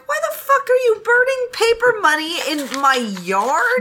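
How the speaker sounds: high, hammed-up yelling villager; mock outraged villager; angry villager. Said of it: "a villager comes up and he's like".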